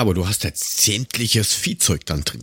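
normal talking voice

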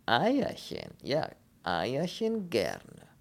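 slow, lilting foreign voice savouring the words; wistful Polish character with swooping pitch; longing. Acted. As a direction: dwell dreamily on a favourite food. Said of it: "Ein Schimmer von Sehnsucht".

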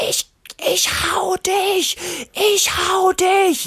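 put-on high, slow, shouted child's fury; angry kid shout. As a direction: play a tiny raging bull yelling. Wütendes Kind, Kampfstier. (wütend gebrüllt wie ein kleines Kind)

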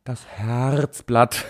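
slow, drawn-out game-show reveal. Acted. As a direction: present it like the big winner. Announcing number one pick.

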